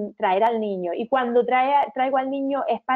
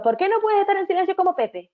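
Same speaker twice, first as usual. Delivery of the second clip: higher, scolding teacher comparing pupils; reproachful teacher; 10 semitones higher than usual. Retold or imitated. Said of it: "oí que un compañero se lo dijo".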